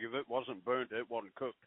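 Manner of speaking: ordinary talk, nothing marked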